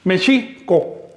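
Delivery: "Mexico" drawn out as "me'chico" with a glottal break, very slow; slow Nahuatl pronunciation. Shown as a demonstration. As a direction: split "Mexico" into two parts with a catch, slowly. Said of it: "Es como si fueran dos palabras". ("Mexico" muy lento, me-chico con saltillo)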